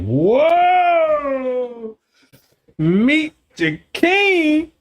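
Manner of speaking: high, drawn-out whoa of wonder; amazed reaction. Acted. Reacting to God's word about meeting a king, amazed. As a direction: stretch it out, climbing high in astonishment.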